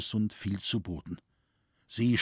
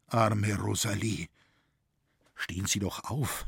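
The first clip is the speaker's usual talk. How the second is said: hushed, sorrowful pity, dropping softer; pained tone; 3 semitones lower, 4 dB quieter than usual. A narrator's reading. Franz urges Rosalie to get up, pained pity. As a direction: let the outcry sink into quiet compassion. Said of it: "rief jetzt Franz mit schmerzlichem Tone".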